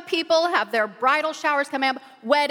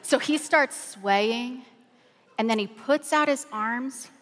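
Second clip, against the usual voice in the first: ordinary talk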